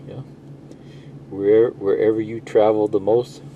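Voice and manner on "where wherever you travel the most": usual speaking voice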